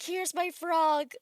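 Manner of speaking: high, loud, slow showing-off; doting, excited show-off; proud frog owner. Acted. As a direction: present your pet proudly, stretching it. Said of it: "He's just like".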